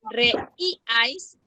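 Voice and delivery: "reíais" slowly split as re, í, ais; syllable-by-syllable demo. Shown as a demonstration. Teacher poses true or false, "reíais" broken as re-í-ais. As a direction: pause between the syllables of "reíais".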